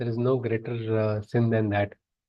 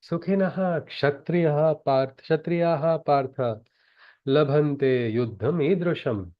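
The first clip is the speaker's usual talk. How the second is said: slow, measured chanting of the shloka; Sanskrit verse recitation; slower than usual. Acted. Teacher reciting the Gita verse to students, slow chant. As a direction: intone the verse slowly, like scripture.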